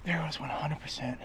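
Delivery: hushed whisper so nobody hears; a real whisper while hiding near the trestle. Real, not acted. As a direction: whisper it, staying hidden. Hiding from a truck.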